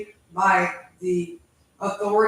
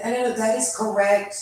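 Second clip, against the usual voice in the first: usual speaking voice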